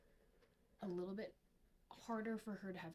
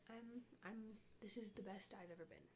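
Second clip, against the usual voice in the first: ordinary talk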